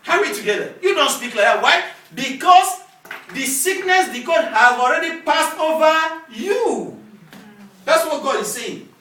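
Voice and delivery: emphatic high-pitched preaching; forceful preaching. Real, not acted. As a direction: drive it home to the congregation, slowing at the end.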